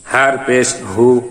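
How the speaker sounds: হু said full and heavy; round, heavy হু. Shown as a demonstration. Teacher models the heavy হু for students. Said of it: "উচ্চারণটি মোটা হবে".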